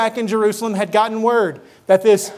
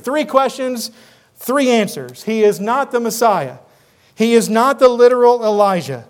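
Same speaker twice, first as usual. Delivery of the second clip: ordinary talk, nothing marked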